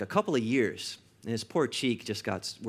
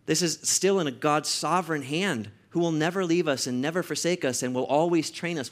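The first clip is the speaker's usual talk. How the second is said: usual speaking voice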